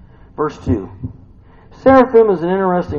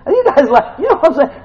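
high, loud speech through laughter; amused, laughing preacher; laughing while talking; 6 semitones higher, 6 dB louder, faster than usual. Real, not acted. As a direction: crack up as you ask. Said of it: "I'm laughing".

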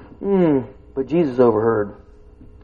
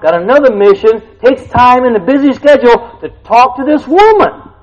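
preaching at full force; 12 semitones higher, 9 dB louder than usual. Acted. Preacher to congregation, at full force. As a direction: hammer it home to the pews.

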